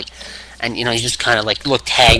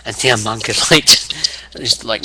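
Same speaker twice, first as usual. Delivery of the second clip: ordinary talk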